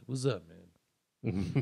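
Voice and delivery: very slow, low, curt; slow, low, distant what's up; cold greeting. Retold or imitated. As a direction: drag it out coldly.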